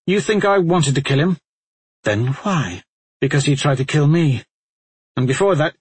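lightly voiced bitter warrior; bitter, rising justification; agitated Talmadge. Talmadge explaining, upset.

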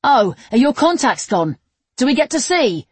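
loud, high-pitched call; shouting from next room. A narrator's reading. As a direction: call through to someone in another room. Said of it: "Jack shouted from the next room".

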